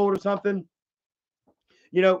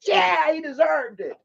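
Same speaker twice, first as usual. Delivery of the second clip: mock viewer; 10 semitones higher than usual. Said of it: "And you're like".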